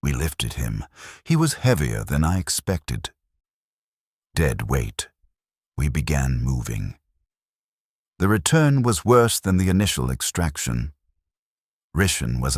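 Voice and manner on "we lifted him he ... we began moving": ordinary delivery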